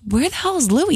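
high-pitched baffled question; retold puzzlement. Owner missing her dog in the kitchen, puzzled. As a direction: ask it high, acting out puzzlement.

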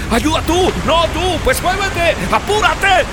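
frantic high panicked cries; desperate. Acted. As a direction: shriek in panic at each other. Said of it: "a desesperar". (gritos agudos de pánico)

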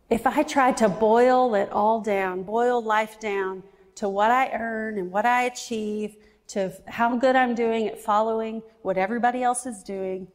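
ordinary delivery

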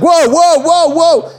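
high, alarmed repeated whoa; a mock startled whoa as the car backs up; imitating a man nearly hit. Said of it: "And the guy's like".

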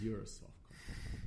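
slow whispered jibe; teasing whisper. Retold or imitated. Dying boy teasing the footballer, slow whisper. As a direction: breathe the insult slowly, teasing. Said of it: "He goes".

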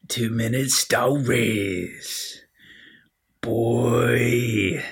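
slow, drawn-out show title in a put-on voice; different announcer voice. Host announcing his lockdown stories show, in a drawn-out put-on voice. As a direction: stretch the title out, long held last word. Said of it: "I went for a different voice this time".

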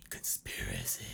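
mock-ominous whisper; a dramatic conspiracy whisper. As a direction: breathe it like a secret.

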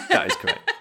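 bright, high quiz host announcement; game show host. Pretend. Quiz host confirming a guess.

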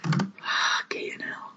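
breathless stunned whisper; barely voiced, slow and hushed. Real, not acted. Stunned reaction to Zeta-Jones film.

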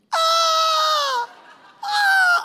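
high, loud imitated crying shriek; piercing mock wail; child's wail. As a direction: mimic a small kid bawling in two bursts. Imitates small son crying on field.

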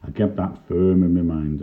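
ordinary delivery

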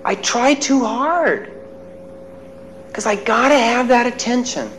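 lightly voiced boy admitting it; quiet child confession. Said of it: "And he says".

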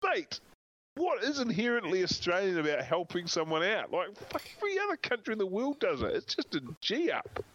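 high, incredulous rant; exasperated retort. Retold or imitated. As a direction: fire it back like you can't believe anyone said it. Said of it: "And I'm like".

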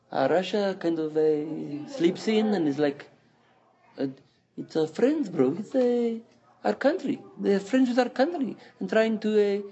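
put-on high, hammy Iranian accent. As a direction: play it as a cartoon Iranian. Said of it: "I now become Iranian accent".

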